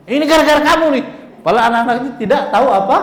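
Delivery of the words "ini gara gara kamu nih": mock scolding. Parent blaming child who fell.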